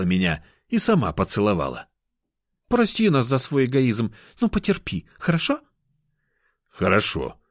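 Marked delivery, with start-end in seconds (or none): 2.7-5.1 put-on high, coaxing girl's voice; pleading cat girl. Girl asking lover to be patient, high coaxing. 5.2-5.6 high, rising little question; pleading cat girl. Acted. Girl seeking lover's consent, high and pleading. 6.7-7.4 falling, sighed agreement; resigned sigh. A narrator's reading. Man agreeing to wait, resigned sigh. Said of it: "Вздохнул".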